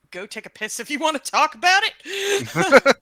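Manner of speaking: loud high hammy shout; shouted joke. Acted. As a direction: yell it out for a laugh. Joking outburst at table.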